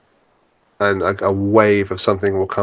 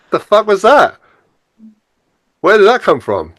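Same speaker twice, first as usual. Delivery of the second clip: startled disbelief; 10 semitones higher, 3 dB louder than usual. Retold or imitated. Retold snapping out of depression.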